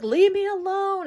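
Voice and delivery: high, loud hammed-up yell; mock yell. Wendy yelling at Peter from the limo, high and loud. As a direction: shout him off, overplayed. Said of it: "And Wendy's like".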